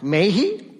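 "May he?" asked with a rising question tune. Shown as a demonstration. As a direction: turn "May he?" into an open question. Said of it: "end it with a question mark".

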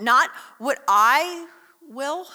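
solemn, deliberate plea; slow emphatic prayer. Acted. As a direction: lean into "Not what I will" slowly. Quoting Jesus' prayer, drawn out.

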